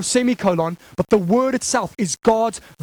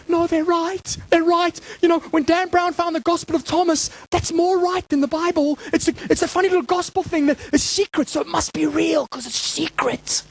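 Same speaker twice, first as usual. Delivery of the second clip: higher, credulous mock voice; mocking gullible believers; 6 semitones higher than usual. Preacher parodies people trusting secret gospels, mockingly credulous. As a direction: put on a higher voice, naively convinced. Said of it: "But we seem to go".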